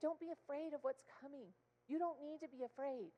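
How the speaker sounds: soft, hushed comfort; tender reassurance. Pretend. Jesus comforting his disciples, softly reassuring. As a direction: whisper comfort to frightened friends. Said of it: "I know he's saying".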